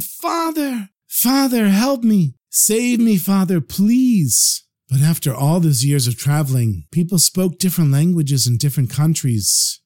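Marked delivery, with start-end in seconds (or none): 0.0-4.6 high, desperate plea, last words slow; crying out to father. Acted. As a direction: beg your father to save you while being beaten. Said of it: "he cried out to his father".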